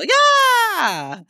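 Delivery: high crying shout of joy; joyful scream. Real, not acted. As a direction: scream it out in delight.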